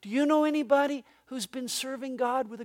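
quiet, searching question; soft and pointed. Real, not acted. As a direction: lean in and ask gently.

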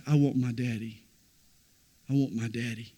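put-on preacher hushes the child's cry into a quiet echo; quiet plea. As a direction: let it almost fade away. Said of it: "When Jesus said".

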